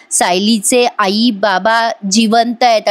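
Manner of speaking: slow, halting words of a patient; a hint of a weak old man, slow; slow, weak speech. Patient whispering the secret to Arjun, slow. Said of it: "Madhubhau म्हणत असतात".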